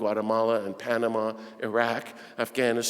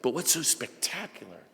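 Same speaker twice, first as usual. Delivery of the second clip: higher, louder preaching surge; preaching at full force; 4 semitones higher, 5 dB louder than usual. Acted. Preacher rouses the church about Gandhi, full-force preaching. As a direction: drive the point home to the congregation.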